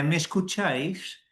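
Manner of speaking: ordinary delivery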